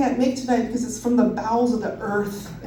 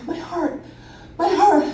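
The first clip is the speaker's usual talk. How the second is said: higher-pitched, mildly acted panic; retold gasp for help; panicked plea; 6 semitones higher than usual. Passenger pleading with flight attendants about her heart, mildly acted panic.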